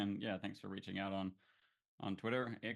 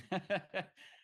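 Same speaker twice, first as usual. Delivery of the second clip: short high burst of laughter; amused laugh at himself; 12 semitones higher, 5 dB louder than usual. Real, not acted. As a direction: laugh it off lightly. Laughs at own impatience.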